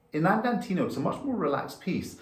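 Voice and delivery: ordinary delivery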